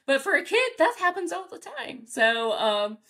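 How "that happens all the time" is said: normal talking voice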